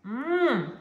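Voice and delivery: normal talking voice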